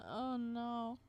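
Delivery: ordinary talk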